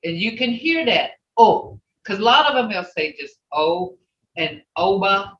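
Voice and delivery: usual speaking voice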